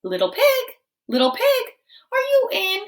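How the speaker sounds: high singsong call at a door. Acted. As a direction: call sweetly into the house. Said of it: "so I called".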